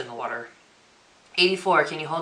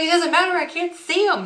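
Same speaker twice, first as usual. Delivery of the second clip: retold outburst, much higher and louder; mad protest; 8 dB louder than usual. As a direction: imitate your own exasperated, high-pitched loud protest. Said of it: "I was so mad".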